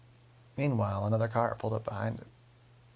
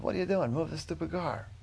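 raised pitch, mildly yelled demand; mock angry yell; 6 semitones higher than usual. Angry driver yelling at Ollie in the parked car, mock yell. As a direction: scold in a higher voice without really shouting. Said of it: "he started yelling at Ollie".

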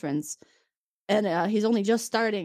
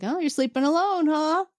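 higher-pitched sly taunt, rising to the end; teasing; 8 semitones higher, 3 dB louder than usual. Retold or imitated. Q popping into Picard's bed, teasing. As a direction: needle them with a nosy, lilting question.

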